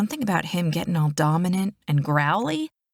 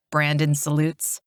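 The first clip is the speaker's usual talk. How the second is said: usual speaking voice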